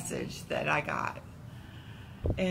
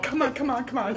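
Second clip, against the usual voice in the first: tearful urging; 6 semitones higher, 5 dB louder, faster than usual. Retold or imitated. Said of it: "I'm bawling".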